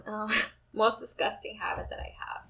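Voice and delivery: whispering to herself while thinking; a laughing under-the-breath aside; whispered aside. Real, not acted. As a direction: whisper it like a private thought.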